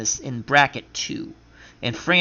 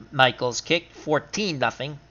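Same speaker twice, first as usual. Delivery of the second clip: ordinary talk, nothing marked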